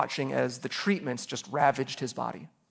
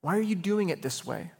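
weary complaint; 4 semitones higher than usual. Retold or imitated. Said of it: "That kind of frustration of".

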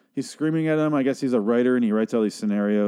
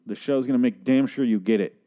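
usual speaking voice

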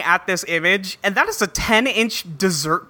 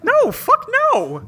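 high, drawn-out horrified no; emphatic refusal; 13 semitones higher than usual. Real, not acted. Refusing to try mayo pizza.

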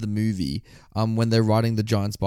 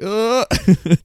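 put-on high-pitched "uh" of a caught kid; mock caught-out groan; 10 semitones higher, 4 dB louder than usual. Kid caught by parents walking in, high awkward groan. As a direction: act lost for words when caught.

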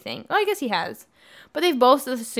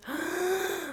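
mock gasp; 7 semitones higher, 12 dB quieter than usual. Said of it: "And then she goes".

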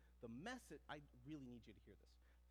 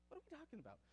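high-pitched, bewildered question; acted-out confusion, voice jumping up; baffled; 8 semitones higher, faster than usual. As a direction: ask it as if completely lost. Acting out his baffled reply.

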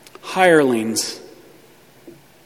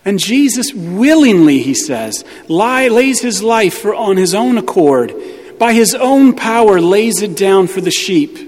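full-force preaching; 5 semitones higher than usual. Acted. Preacher rising to a climax assuring the sheep, preaching at full force. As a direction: build it up like a pulpit climax.

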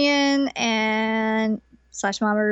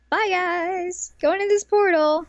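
cheery heroine; 8 semitones higher, slower than usual. Retold or imitated. Usagi voiced leaving.

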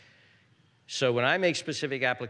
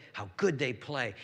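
ordinary talk, nothing marked

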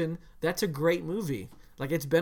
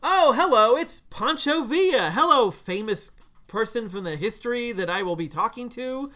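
mock cheery greeting; 4 semitones higher, 6 dB louder than usual. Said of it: "where he'd be like".

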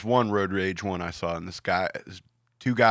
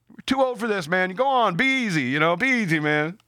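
lightly imitated, higher-pitched send-off; placating driver; 8 semitones higher than usual. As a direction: wave him off with friendly advice. Acts out driver calming things down.

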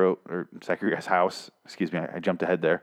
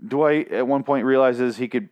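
ordinary talk

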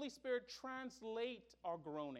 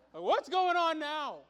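loud, high, drawn-out mock despair; hammed-up exasperation; 8 semitones higher, 13 dB louder, slower than usual. Husband calls his wife about more crying, comically exasperated. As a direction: wail it slowly, playing up the helplessness.

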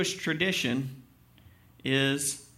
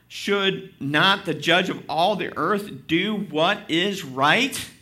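loud, driving preacher's reading of the passage; fervent scripture reading; 5 dB louder than usual. Acted. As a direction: read Abraham's plea with full conviction. Pastor reads scripture aloud.

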